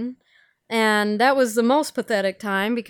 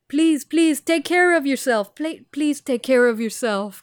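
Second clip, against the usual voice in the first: put-on Indian accent; 3 semitones higher than usual. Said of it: "Probably mine isn't either".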